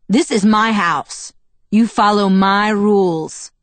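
slow, loud, emphatic order; angry command. A narrator's reading. Father claims his house and rules, angrily commanding. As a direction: lay down the law, each word hammered.

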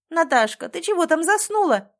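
loud, high impatient call. Acted. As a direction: shout to someone out of sight. Calling missing niece.

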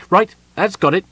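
high, loud, businesslike little voice; brisk gnome. A narrator's reading. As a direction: wrap it up briskly. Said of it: "said the gnome briskly".

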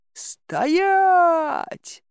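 high, drawn-out roar of command; гулкий рёв. Acted. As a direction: roar the order like an enraged castle. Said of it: "Послышался гулкий рёв". (протяжный грозный рёв)

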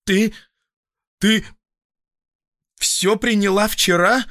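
aghast, halting, then high and loud; wild voice. A narrator's reading. Doctor learning patient took the whole bottle, wildly aghast. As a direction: stammer it out in wild disbelief. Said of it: "спросил я диким голосом".

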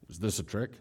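low, hushed wary question; guarded husband under his breath; suspicious husband. Acted. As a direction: ask it softly, as if smelling a trap. Acted husband suspects a trap.

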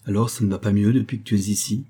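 ordinary delivery

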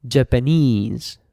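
"Japanese" slowly, last syllable stressed; "Japanese" as japaNESE, slow teacher's model; final-syllable stress. Shown as a demonstration. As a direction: stretch the final "nese" in "Japanese". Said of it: "falls on the final syllable".

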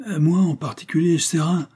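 unsure, halting testimony; stammering hesitation. A narrator's reading. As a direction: answer reluctantly, feeling for words.

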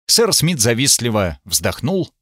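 ordinary delivery, nothing marked

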